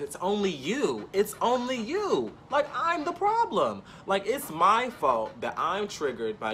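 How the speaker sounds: slow, climbing high-pitched mock blame; drawn-out mimicked accusation; mock accusing. Mimics friend blaming her in quarrel.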